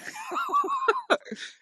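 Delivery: very high-pitched fit of laughter; helpless high giggling fit; laughing alone. Real, not acted. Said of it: "laughing alone".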